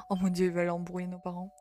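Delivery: ordinary delivery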